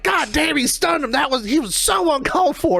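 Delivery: high-pitched mock shock; shocked commentator. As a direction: JR losing it over the stunner. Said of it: "he was like".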